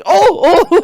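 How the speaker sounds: high, loud "oh, oh"; startled host. Retold or imitated. The host gasps high and loud at his joke. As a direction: gasp it like a shocked host. Host reacts to fly zip.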